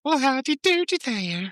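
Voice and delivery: very high falsetto Southern belle; high mock Southern lady; female American voice. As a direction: trill it like a genteel American lady. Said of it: "my American mistress".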